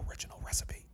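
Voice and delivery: unvoiced, whispered throwaway joke; whispered aside. Real, not acted. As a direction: breathe it as a sly aside.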